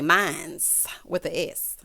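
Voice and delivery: "mines" said slowly with a buzzing final S. Shown as a demonstration. Correcting herself to the slang "mines", slow and drawn. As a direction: stretch out "mines with the S", leaning on the S. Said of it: "as the people say".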